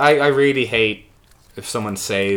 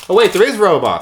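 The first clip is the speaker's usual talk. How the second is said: ordinary talk, nothing marked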